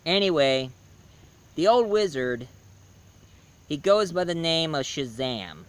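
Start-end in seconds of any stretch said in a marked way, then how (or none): none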